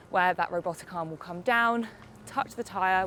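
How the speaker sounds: usual speaking voice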